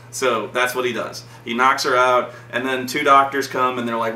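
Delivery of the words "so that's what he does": ordinary talk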